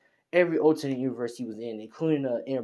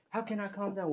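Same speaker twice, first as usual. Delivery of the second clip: angry retort; 9 semitones higher than usual. Acted. Furious character told to calm down, angry retort. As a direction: throw it back in a high, upset voice. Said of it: "getting angry and says".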